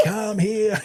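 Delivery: high-pitched mock ogre call; Shrek chasing gremlins. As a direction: imitate a big ogre calling after fleeing gremlins. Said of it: "It was like Shrek chasing, you know, little gremlins".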